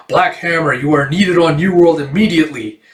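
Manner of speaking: lightly voiced superhero commander; urgent summons.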